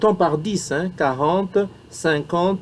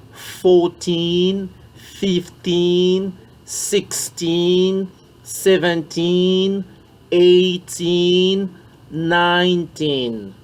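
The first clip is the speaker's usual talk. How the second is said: put-on slow, rhythmic, each teen drawn out; 2 semitones higher, much slower than usual. Shown as a demonstration. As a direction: beat out the teen of every number. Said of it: "mettre les rythmes dans la prononciation". (rythmé, en appuyant sur les teen)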